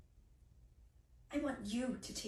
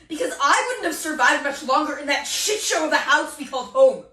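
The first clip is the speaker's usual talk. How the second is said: loud, raised-pitch anger; acted angry tirade at full volume; heated outburst; 4 semitones higher, 12 dB louder than usual. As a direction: let the resentment burst out loud.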